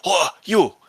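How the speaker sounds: high, loud call to stop; shout from behind. Acted. Official shouts at fleeing Jacob and Sir Edward, loud and high. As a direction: yell after them to turn around. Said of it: "hear a shout from behind you".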